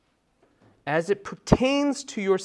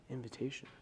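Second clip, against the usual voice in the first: gentle invitation; 4 semitones lower, 18 dB quieter, faster than usual. Shown as a demonstration. Preacher showing the mood switch from law to grace, gently inviting. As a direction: ease off into a hushed, inviting tone. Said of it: "the mood switches from demanding expectation".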